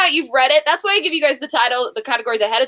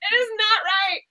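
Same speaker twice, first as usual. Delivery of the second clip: softer, squeaky through laughter; laugh-crying; 7 semitones higher, 4 dB quieter than usual. Real, not acted. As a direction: deliver it while crying with laughter. Host laughing at made-up title.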